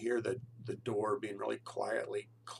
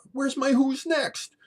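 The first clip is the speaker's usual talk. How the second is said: much higher, loud mock outrage; frantic yelling roommate; 13 dB louder, slower than usual. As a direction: yell like a roommate who can't find his record. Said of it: "and then he goes".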